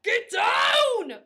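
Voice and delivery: high, loud roaring command; captain roaring an order at his squad; roared. Acted. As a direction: roar it at his men to drop. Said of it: "Brennan roared".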